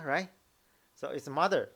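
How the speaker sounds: normal talking voice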